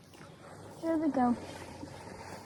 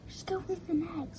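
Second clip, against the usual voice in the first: hushed plea to the fish; whispering; 4 semitones higher, 10 dB quieter than usual. Real, not acted. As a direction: whisper it under your breath. Repeats urging, hushed.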